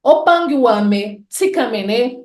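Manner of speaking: Opanguame said slow and careful; Kimbundu greeting. Shown as a demonstration. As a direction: say Opanguame slowly, syllable by syllable. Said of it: "Opanguame significa".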